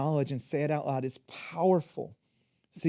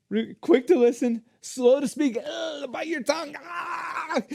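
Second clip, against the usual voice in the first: high, strained mock struggle to hold back; hammed-up effort at self-restraint; straining restraint; 9 semitones higher, 4 dB louder than usual. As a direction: act out biting your tongue, ending in strangled cries. Acts out restraining an angry response.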